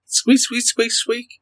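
high squeaky mouse noises; high mouse squeaking; mouse squeaks. Acted. GM voicing rats squeaking.

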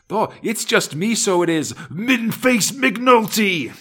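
high, slow sing-song brogue; cheery Irish brogue. Acted. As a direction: answer the guard in a jaunty brogue. McNulty voiced answering the guard.

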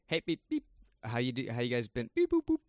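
robot beeps mixed into chatter; high robot beeps around a greeting; cyborg voice. Shown as a demonstration. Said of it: "Is that Cyborg talk?".

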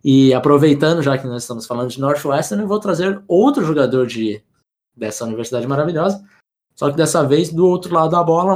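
usual speaking voice

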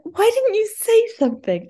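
high-pitched incredulous question; retold squeak of exasperation; exasperated disbelief. To partner who never adjusted the bike, exasperated disbelief.

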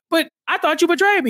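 high, fast, wounded protest; hammed-up betrayed bewilderment; mock hurt. Host acting out a player confronting a supposed betrayer, mock hurt. Acts out Siwon confronting ally.

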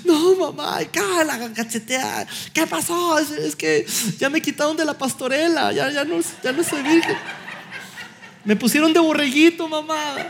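put-on high, tearful girl's voice with a laugh; crying teen girl. A teenage daughter tearfully explaining the nativity play to her mother. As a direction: whimper it like a sobbing teenage daughter.

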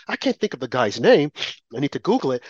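usual speaking voice

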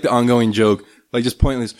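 ordinary delivery

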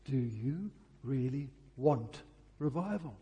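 normal talking voice

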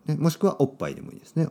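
ordinary talk, nothing marked

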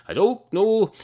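barman's high, exasperated "I don't know"; high squeak. Acted. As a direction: jump up into a high helpless yelp. Barman repeats, voice jumps.

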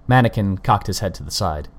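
ordinary delivery

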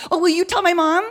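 put-on high girl's plea; pleading girl. Girl asks canvasser to phone mom, high pleading voice. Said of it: "And she says".

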